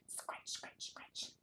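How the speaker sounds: whispered scratchy "scratch" noise; scratching sounds. Pretend. Acting out scratching for a toddler, quick raspy whisper. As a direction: make the word sound like claws scraping. Acting out the book's action word.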